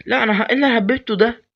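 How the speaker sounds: high, loud panicked yelp; shocked outburst. Real, not acted. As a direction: blurt it out in alarm. Panicked gamer reacts to mistake.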